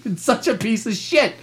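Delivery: usual speaking voice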